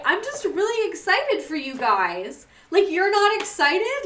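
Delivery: high, drawn-out, hammed-up tearful gushing; mock happy crying. Teacher gushing to students who aced a test, mock happy tears. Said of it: "I would be, like, crying".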